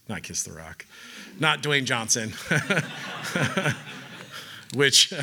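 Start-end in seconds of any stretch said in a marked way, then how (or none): 2.4-4.7 silent laugh. Real, not acted. Laughing at own Dwayne Johnson joke.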